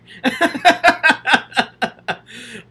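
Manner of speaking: bright, loud giggle at herself; sheepish laugh after shaking the camera; embarrassed laugh. Real, not acted. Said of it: "I'm kinda shaking the camera".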